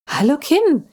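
put-on high, excited child's greeting; gleeful high hello; enthusiastic call. A narrator's reading. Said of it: "rief Lissy begeistert".

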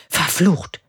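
plain reading voice

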